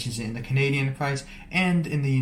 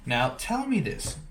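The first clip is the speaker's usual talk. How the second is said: normal talking voice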